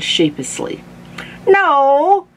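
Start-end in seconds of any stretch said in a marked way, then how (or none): 1.5-2.3 put-on very high, loud old man; hammed-up cross Grandpa; angry shout. A narrator's reading. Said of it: "shouted Grandpa angrily".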